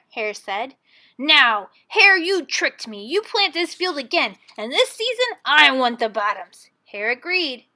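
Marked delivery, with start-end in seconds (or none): 1.2-6.7 loud, stern bear demand; a grumpy bear laying down terms; angry Bear. Acted. Bear demands a new deal.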